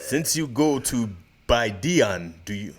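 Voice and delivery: slow, halting fake Russian accent; laboured comic Russian accent; mock Russian accent. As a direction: read aloud like a stage Russian. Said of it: "read the comments in Russian".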